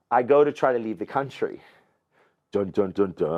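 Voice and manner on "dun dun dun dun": low sung dun dun dun, hammed-up; mock dramatic sting. Telling a friend the trouble starts, mock suspense sting. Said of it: "dramatic sting after leaving country".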